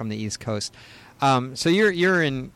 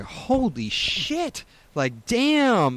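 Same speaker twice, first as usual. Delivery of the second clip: drawn-out, high-pitched amazement; stunned, slow-motion reaction; awestruck; 10 semitones higher, much slower than usual. Retold or imitated. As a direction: stretch it out slowly, voice swooping high in retold awe. Retelling stunned reaction to her entrance.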